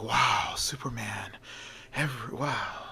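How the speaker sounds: hushed, breathless wonder; awed whisper. Acted. Crowd gazing at the flying man, awed whisper. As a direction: breathe his name in awe.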